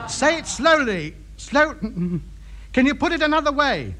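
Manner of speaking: high, slow, over-careful request; slowed for a foreigner. Acted. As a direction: spell it out slowly to a foreign listener. Coaxing foreign radio contact to slow down.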